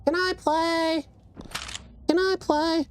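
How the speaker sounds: put-on high-pitched pestering little kid voice; squeaky mock whine, drawn out at the end; begging kid. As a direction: mimic a kid pleading to join in. Mimics kids begging to join.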